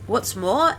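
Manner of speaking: ordinary talk, nothing marked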